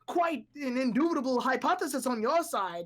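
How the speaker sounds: hammed-up sophisticated genius; posh genius voice. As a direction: ham up a pompous know-it-all. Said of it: "that high, sophisticated, like, super smart".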